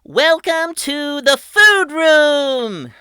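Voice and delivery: high, loud and slow showman voice; grand welcome. A narrator's reading. Patrick presenting the bubble room, slow and grand. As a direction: draw it out like a ringmaster. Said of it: "he said".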